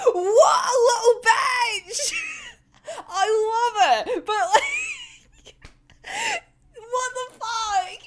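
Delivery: high, squealing laughter through words; real hysterics. Real, not acted. Fan reacting to Dazai's petty newsletter, helpless laughing. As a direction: lose it laughing, dragging the words out.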